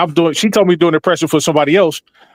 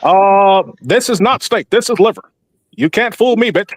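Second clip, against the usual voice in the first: higher, hammed-up presidential indignation; Obama cussing out; 6 semitones higher, slower than usual. Comedian voicing Obama complaining to the waiter, hammed-up outrage. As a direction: act offended over liver instead of steak. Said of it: "imagine him in a regular setting".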